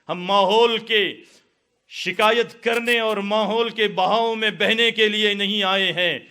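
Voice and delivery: loud, high, rousing sermon voice; fiery preaching. Real, not acted. Preacher rouses the young men against complaining, at full force. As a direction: preach it hard to the crowd.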